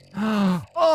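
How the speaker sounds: high, sudden intake of breath; sharp gasp. Real, not acted. Caster watching a huge save, sharp gasp. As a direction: gasp in shock at the play.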